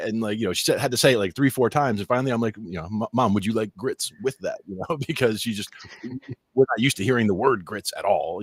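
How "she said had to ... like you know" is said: ordinary delivery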